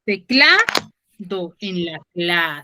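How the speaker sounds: "Teclado" drawn out slowly, leaning on cla; teacher stretches "Teclado" so the class hears the stress land on cla; slow stress demo. Shown as a demonstration. Teacher confirms the answer, slow and deliberate. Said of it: "dónde tiene la mayor fuerza de voz".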